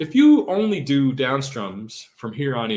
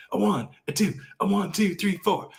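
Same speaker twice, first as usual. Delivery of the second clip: imitating a drummer counting in, pitched higher; 7 semitones higher than usual. Guitar teacher mimicking a drummer's count-in, higher voice. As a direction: call the count like a drummer before a take. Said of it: "you hear them going".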